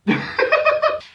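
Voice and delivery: sudden loud squealing laugh; high burst of laughter. Real, not acted. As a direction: crack up at the script. Breaking up at the absurd script.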